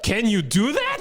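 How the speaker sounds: wife stunned he admits she's right; amazed disbelief. Retold or imitated. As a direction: ask it as if you can't believe your ears. Wife stunned by his apology.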